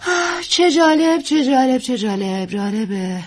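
gush, then let it die away; gushing fading out. Acted. Actress coos at young wife, high gush fading down.

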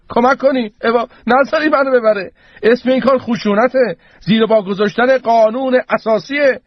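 high, frantic appeal for help; pleading. A narrator's reading. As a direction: beg the crowd to step in. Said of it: "با التماس رو به جمعیت". (با التماس و صدای زیر)

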